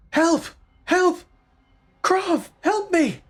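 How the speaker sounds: very high, loud cry for rescue; shouted plea for help. Acted. Captured sister crying out to Krav, high-pitched shouting. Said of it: "they shout".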